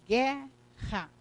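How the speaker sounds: "guerra" drawn out as gue... rra; demonstrated pronunciation. Shown as a demonstration. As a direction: pronounce "guerra" in two separate syllables, U silent. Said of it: "o que eu vou pronunciar aqui". ("guerra" separando as sílabas: gue - rra)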